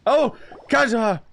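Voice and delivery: excited exclamation. Real, not acted. Streamer spotting a favorite character, excited exclamation. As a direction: greet the character with a real burst of excitement.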